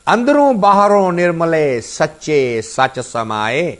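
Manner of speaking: fast, chanted verse recital; scripture recitation. Acted. Teacher reciting Gurbani verse to listeners, fast rhythmic recitation.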